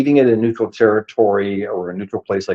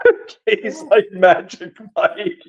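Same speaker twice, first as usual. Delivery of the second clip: laughing; 7 semitones higher than usual. Real, not acted. Laughing over Mike's trick.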